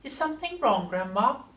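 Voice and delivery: high, polite grandson voice; polite asking. A narrator's reading. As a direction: ask sweetly, pitched well up. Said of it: "George asked her politely".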